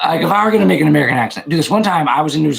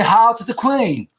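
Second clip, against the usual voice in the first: mock British toast, rising higher and slowing; put-on British accent; 6 semitones higher, slower than usual. Streamer finishing the British day plan, mock British accent. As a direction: proclaim it in a drawn-out British accent. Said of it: "talk like we're British".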